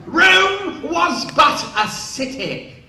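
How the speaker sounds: slow, high, loud slurred declaiming; a legless star booming his line; drunk grand actor. Retold or imitated. O'Toole staggering to the set, slow booming drunk line.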